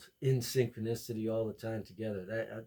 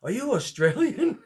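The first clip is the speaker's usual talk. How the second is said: high, loud, amazed woman; mock-thrilled lady, much higher and louder; excited lady; 12 semitones higher, 11 dB louder than usual. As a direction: gush like a starstruck shopper. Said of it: "And she goes".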